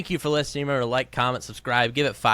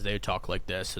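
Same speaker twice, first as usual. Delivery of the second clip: low, quiet droning pundit voice; monotone voice; 4 semitones lower, 6 dB quieter than usual. Retold or imitated. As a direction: drone it out like a bored analyst. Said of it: "guys in monotone voices".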